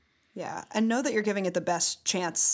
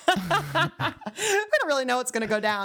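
laughing; 5 semitones higher, faster than usual. Real, not acted. Laughing intro to the show.

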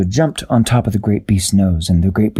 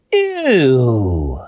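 disgusted ew; 3 semitones higher than usual. A narrator's reading. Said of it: "Dashi woke up and went".

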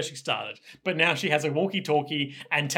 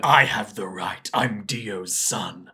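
mock villain pronouncement; 7 semitones lower, much slower than usual. Villain vows to reach heaven.

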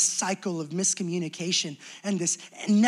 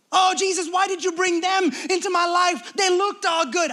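higher and louder, a wailing protest; whiny complaint to Jesus; 7 semitones higher, 5 dB louder than usual. Acted. Betrayed believer cries out to Jesus, high whiny complaint. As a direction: plead like someone let down.

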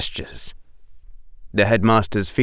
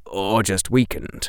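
plain reading voice

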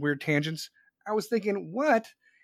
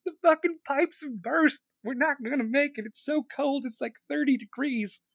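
high-pitched hammed-up country-boy panic; mock panic; 10 semitones higher, 4 dB louder than usual. Streamer mocking Texans in an ice storm, hammed-up panicked wail. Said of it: "fucking ice shows up, you're like".

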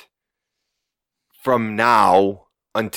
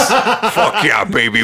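high whoop through a laugh; laughing cheer; 9 semitones higher, 4 dB louder than usual. Real, not acted. Cheering about the dairy mimosas.

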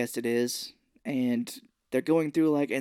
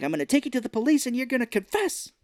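mock threat; 10 semitones higher, faster than usual. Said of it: "he's threatening him".

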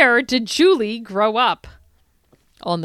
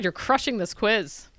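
ordinary talk

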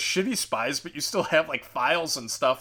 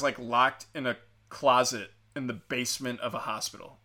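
normal talking voice

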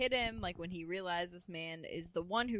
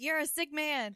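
angry retort; 8 semitones higher, 4 dB louder than usual. Retold or imitated. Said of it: "yelling at him".

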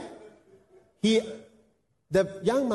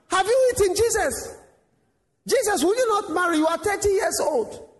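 pestering villager; 11 semitones higher than usual. Acted. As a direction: nag him about eating and marrying.

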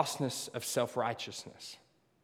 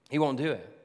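ordinary talk, nothing marked

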